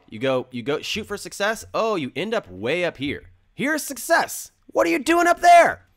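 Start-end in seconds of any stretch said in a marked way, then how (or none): 3.5-5.9 much higher, louder, hammed-up astonishment; mock surprise. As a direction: gasp at how far above the goal you landed. Voicing surprise at overshooting success.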